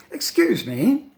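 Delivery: high, slow, exaggerated polite call; course book dialogue. Shown as a demonstration. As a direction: lilt it like a textbook stranger seeking attention. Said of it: "course book dialogues".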